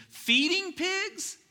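high, slow, stretched-out disbelief; incredulous disgust. Pretend. Pastor to church, aghast at feeding pigs, high and drawn out. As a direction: say it like it's unthinkable. Pastor mocks the pig job.

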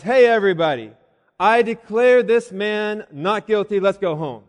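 loud public proclamation; acted loud declaration, easing off at the end; calling out. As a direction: play a bystander announcing to the whole room. Imagined spectator stands up, addresses courtroom.

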